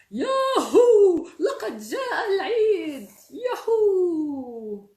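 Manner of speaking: high, drawn-out holiday cheer; whooping storybook celebration; joyful loud shout. Acted. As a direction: shout it out loud, stretching the words. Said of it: "ثم صرخ بصوت مرتفع".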